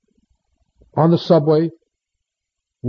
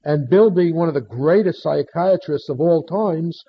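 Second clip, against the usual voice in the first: ordinary talk, nothing marked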